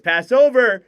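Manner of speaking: spring on the pass; excited call. Acted. Scoring chance call.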